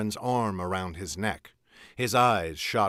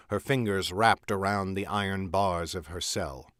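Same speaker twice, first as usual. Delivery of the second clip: plain reading voice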